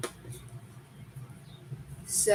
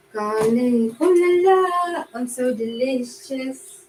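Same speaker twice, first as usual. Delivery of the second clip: French chef; 3 semitones higher, 3 dB louder, slower than usual. Pretend. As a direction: ham up a French cook, slowly. Food voiced in French manner.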